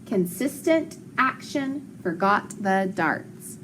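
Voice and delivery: slow, measured read-along; word-by-word teacher reading; slow choral reading. Shown as a demonstration. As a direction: read it slowly for a class to join in. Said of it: "Let's read it one more time all together".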